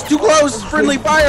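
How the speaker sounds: urgent callout. Real, not acted. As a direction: warn your teammate fast.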